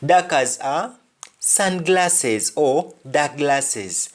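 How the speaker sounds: ordinary delivery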